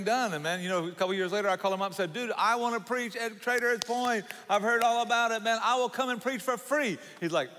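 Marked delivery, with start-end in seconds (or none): 2.1-7.0 higher-pitched, earnest begging to a friend; eager plea. Retold or imitated. Preacher retells begging his friend to let him preach, eager plea. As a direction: re-enact pleading for a chance, pitched up.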